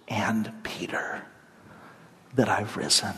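a hushed aside naming Peter; hushed emphasis. Acted. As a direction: breathe the name as a tender secret. Jesus' message singling out Peter.

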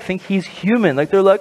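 ordinary delivery

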